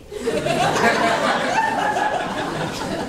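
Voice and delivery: breathless, high-pitched giggle; high airy laugh. Real, not acted. Telling the story of Menya's fall, a high breathy laugh. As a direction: burst into a high silent laugh.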